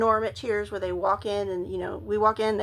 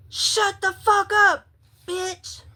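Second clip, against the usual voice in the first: high, drawn-out retold shout; imitating a furious heckler, pitched up; angry stranger yelling; 10 semitones higher, 4 dB louder, slower than usual. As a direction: yell it across the yard as an irate stranger.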